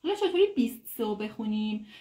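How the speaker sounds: ordinary talk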